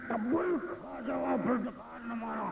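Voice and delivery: booming filmi challenge, pitched high and drawn out; Sultan Rahi roar. Retold or imitated. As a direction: roar it out like a Lollywood hero. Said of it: "कहा है सुल्तान राही ने कुछ इस तरह से".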